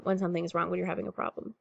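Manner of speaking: ordinary delivery, nothing marked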